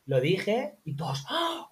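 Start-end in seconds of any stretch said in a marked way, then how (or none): 1.3-1.7 shocked gasp. Retold or imitated. Kids gasp at teacher's word.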